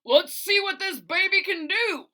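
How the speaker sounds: high, loud gleeful shout; daredevil shout. A narrator's reading. Bus driver in his helmet, a gleeful shout. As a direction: shout it like a reckless racer revving up. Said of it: "puts on his helmet and shouts".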